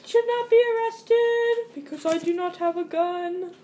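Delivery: high sing-song chant. Pretend. Suspect pleading innocence in the rhyme game, high voice.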